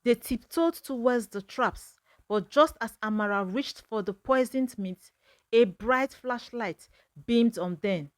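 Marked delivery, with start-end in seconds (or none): none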